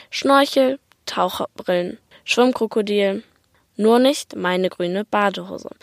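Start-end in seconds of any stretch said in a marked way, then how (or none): none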